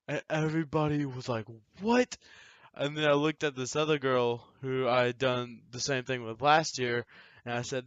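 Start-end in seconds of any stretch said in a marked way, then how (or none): 1.8-2.2 high, loud shocked "What?". Retold or imitated. Cast members react to the fake confession, shocked. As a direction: gasp it out in disbelief.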